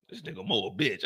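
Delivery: low dismissive heckler; deep mocking chat voice. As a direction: drop low like a sneering heckler. Voicing the chat insulting him.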